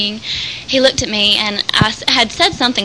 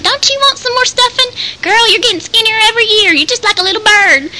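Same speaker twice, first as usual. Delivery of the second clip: high, loud imitation of a fussing great-grandmother; 13 semitones higher, 5 dB louder than usual. Great-grandmother urging more Thanksgiving stuffing on her great-grandchild, high loud fussing. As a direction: fuss loudly, pitched way up, as Great-grandma. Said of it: "she used to fuss at me".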